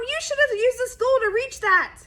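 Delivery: a mom yelling at her son over the mess. A narrator's reading. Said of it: "yelled his mom".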